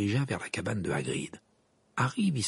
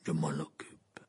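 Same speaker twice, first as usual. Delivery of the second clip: low, gruff, brief reply; growling; 4 semitones lower, 4 dB quieter, slower than usual. A narrator's reading. Moody takes on the search, growling. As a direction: grunt it low. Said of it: "grogna Maugret".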